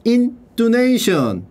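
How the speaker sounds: slow stretched pronunciation; slow stretched word. Shown as a demonstration. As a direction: draw out intonation for the class. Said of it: "drawing out vocabulary word".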